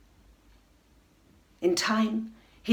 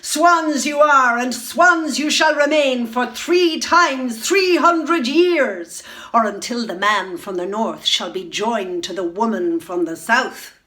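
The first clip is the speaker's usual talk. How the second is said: a stepmother witch shouting her curse down on the swans; gloating shout; 4 semitones higher, 8 dB louder than usual. Acted. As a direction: bellow the spell with cruel triumph. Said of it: "triumphant, gloating shout".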